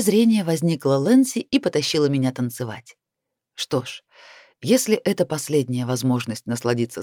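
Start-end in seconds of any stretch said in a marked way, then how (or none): none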